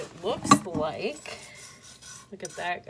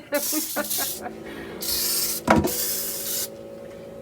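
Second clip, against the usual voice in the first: breathless laugh; 8 semitones higher than usual. Real, not acted. Laughing at ex-husband teasing.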